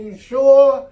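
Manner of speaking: high, loud shouted "You sure?"; yelled question. Acted. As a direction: yell it up over the noise. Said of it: "The pilot yelled".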